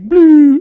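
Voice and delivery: loud high electronic blip; machine sound. Retold or imitated. Imitating the machine when his money runs out, a loud blip. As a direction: imitate the losing slot chime.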